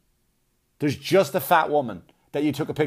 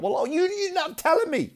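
mock wife voice; 13 semitones higher than usual. Wife's voice, acted.

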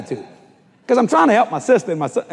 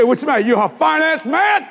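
mocking salesman taunt; 8 semitones higher than usual. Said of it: "He said".

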